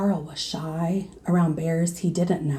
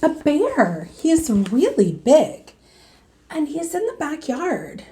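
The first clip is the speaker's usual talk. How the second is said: put-on excited child; 8 semitones higher, 4 dB louder than usual. Boy describes the bear.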